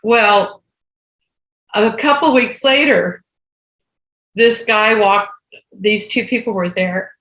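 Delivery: ordinary delivery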